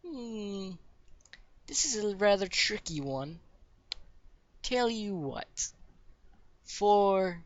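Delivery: put-on high, pondering old-lady voice; elderly woman. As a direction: draw words out slowly while sizing up a tricky job. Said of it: "it's, like, an elderly woman".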